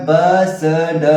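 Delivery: "बस ड" as separate drawn-out sounds, ba, sa, da; very slow phonics reading of "बस ड"; letter-sound drill. Shown as a demonstration. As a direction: sound out each letter in "बस ड", held and apart. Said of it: "इसको क्या पढ़ेंगे".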